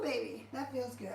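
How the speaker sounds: normal talking voice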